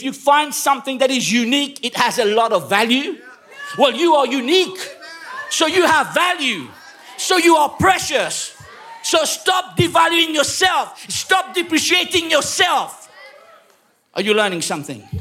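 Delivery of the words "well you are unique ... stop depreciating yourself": climbing sermon shout; preaching at full force. Acted. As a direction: drive it home like a revival preacher. Rallying the congregation.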